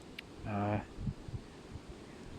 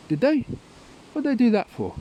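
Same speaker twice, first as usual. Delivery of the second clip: much higher, louder mock of Tom; Tom's naive question; 11 semitones higher, 5 dB louder than usual. Tom asks nan, high and loud, clueless. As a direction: play the innocent, squeaky and loud.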